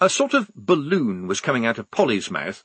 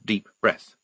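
ordinary delivery

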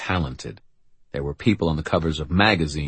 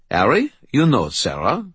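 put-on higher, lilting French-accented voice; 7 semitones higher, 4 dB louder than usual. A narrator's reading. Brother introduces his companion, French accent. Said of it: "said in his French accent".

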